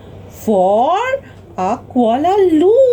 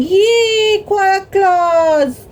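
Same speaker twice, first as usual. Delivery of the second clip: high, drawn-out cheer for the champion; cheering crowd; 6 semitones higher, slower than usual. A narrator's reading. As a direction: cheer them on in a raised, sing-out storyteller's voice. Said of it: "Everybody started cheering".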